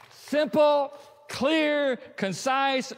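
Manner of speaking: high, loud, one word at a time; triumphant preacher's summing up; excited emphasis. Real, not acted. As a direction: punch each word home. Punchline after "Boom.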